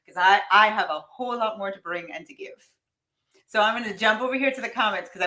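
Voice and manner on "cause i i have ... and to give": normal talking voice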